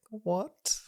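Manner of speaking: put-on high, puzzled little girl voice; high mock-childish what; little girl's what. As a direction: squeak it out like a confused kid. Girl voiced in a retold scene.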